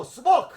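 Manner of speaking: "Subak!" shouted high and loud, the way the Korean student said it; student's excited correction "Subak!"; correct Korean pronunciation. Retold or imitated. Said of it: "I was saying it wrong".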